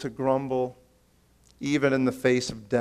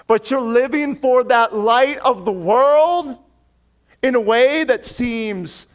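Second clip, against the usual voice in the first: higher, louder preaching push; impassioned preaching; 8 semitones higher, 3 dB louder than usual. Acted. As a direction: preach it with rising conviction.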